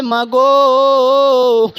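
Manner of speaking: high, loud, long-held chanted cry; soaring melodic call to mother. Acted. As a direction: belt it out and stretch "গো". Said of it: "Anas ডাক দিয়া বলে".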